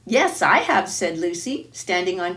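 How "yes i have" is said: eager. Acted. Lucy eagerly offers handkerchief.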